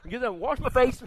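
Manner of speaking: loud, brisk voice of a man over it; mock brisk David. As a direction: shrug off the grief, briskly.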